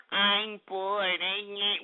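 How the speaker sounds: soft, droning mock complaint; mocking whiner. Host mimicking bored chat room listeners, mocking whine.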